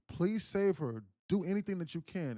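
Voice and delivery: imitating a frantic appeal; desperate plea. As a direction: beg urgently for a life to be saved. Father begging the ambulance crew.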